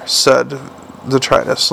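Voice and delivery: ordinary delivery, nothing marked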